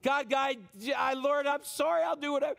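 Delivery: frantic mock begging; begging God. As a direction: plead as if bargaining for help. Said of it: "where you beg God".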